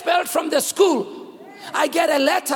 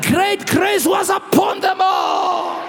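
loud rising sermon cadence; preaching; 5 semitones higher, 4 dB louder than usual. Acted. Pastor preaching to his congregation, full-voiced sermon. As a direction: preach it out to the whole church.

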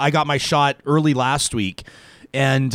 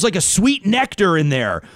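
normal talking voice

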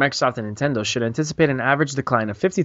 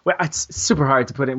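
normal talking voice

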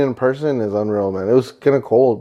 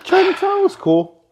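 high mock voice; slower than usual. Real, not acted. Mock enthusiastic remark.

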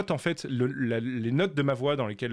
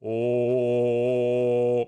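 low note; 4 semitones lower than usual. Shown as a demonstration. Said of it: "Moi, je suis une voix".

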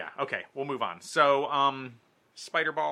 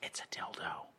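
breathy whispered punchline; whispering; 10 dB quieter than usual. Acted. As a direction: drop to a whisper for the joke.

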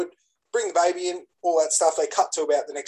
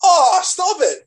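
loud, high, drawn-out "oh"; slow wail of mock heartbreak; pained protest; 14 semitones higher, 7 dB louder, much slower than usual. Real, not acted. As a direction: react as if it's too sad to bear. Reacting to mention of Mufasa's death.

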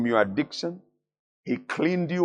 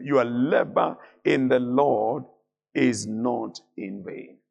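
slow emphatic scripture; 3 semitones lower, slower than usual. Shown as a demonstration.

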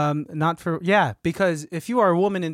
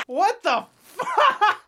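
very high, drawn-out through laughter; real cracking up, words squeezed out high; helpless laughter; much slower than usual. Real, not acted. Performer breaks after improv scene, laughing helplessly.

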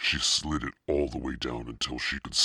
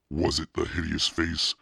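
usual speaking voice

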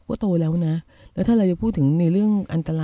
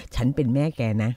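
mock stern mother; 7 semitones lower, 4 dB quieter than usual. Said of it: "มันจะจบลงด้วย".

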